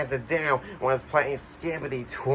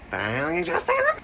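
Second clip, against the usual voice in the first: outraged squeak. Acted. Outraged contestant retort.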